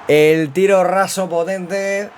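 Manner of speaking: high, loud and slowly drawn out; excited. Real, not acted. Telling the chicos about the winning shot, drawn-out triumph. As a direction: stretch the words out in triumph. Celebrating goal to viewers.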